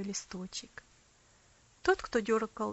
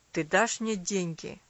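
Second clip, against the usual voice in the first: plain reading voice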